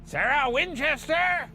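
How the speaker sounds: high, drawn-out mock holler; imitated stomping yell. Friend imitates the player's solo phase, high mock yell. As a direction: holler it like someone stomping around. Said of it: "stomps around and yells".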